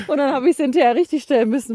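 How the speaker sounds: giggling while owning up; laughing. Real, not acted. Admitting own mistake, laughing.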